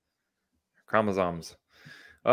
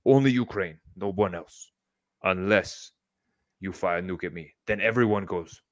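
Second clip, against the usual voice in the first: measured mock Russian accent; deliberate Russian accent, spaced phrases; Putin; 2 semitones lower than usual. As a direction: deliver a threat in amateur Russian English. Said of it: "do that in a Russian accent".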